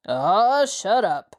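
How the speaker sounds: a touch of a gruff shut-up; lightly voiced irritated gang leader; growled. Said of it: "the leader growled".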